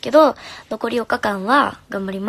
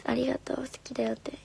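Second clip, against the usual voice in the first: whispered sweet nothings to a fan; 3 semitones higher, 9 dB quieter than usual. Acted. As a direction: whisper it close, like a secret. Reading a fan's sweet comment.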